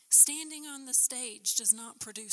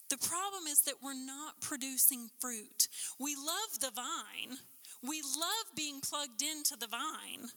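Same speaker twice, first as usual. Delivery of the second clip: usual speaking voice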